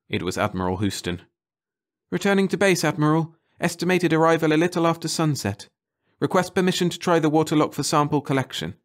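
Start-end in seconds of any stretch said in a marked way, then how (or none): 2.1-8.7 higher, formal report; lightly voiced crisp scientist; Leakey reporting. Leakey reporting to the admiral, higher and crisp.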